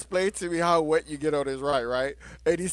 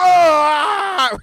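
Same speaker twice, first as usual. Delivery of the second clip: high, loud mock scream; retold scream; 12 semitones higher, 11 dB louder than usual. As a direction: scream like a scared rider. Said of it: "he's like".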